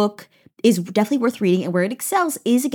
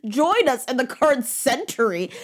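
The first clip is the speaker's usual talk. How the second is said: mocking dismissal; 4 semitones higher, much slower than usual. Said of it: "everyone's like".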